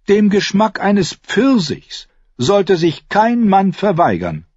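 high, drawn-out airy voice, teasing; lightly mocking lord, pitched high; Renly, teasing younger brother. A narrator's reading. (hohe, spöttisch-leichte Stimme)

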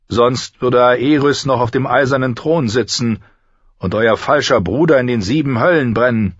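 high-pitched defiant condemnation; grim old knight commander. Acted. Old Kingsguard commander telling Ned of the false brother, defiant. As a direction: condemn them with proud force.

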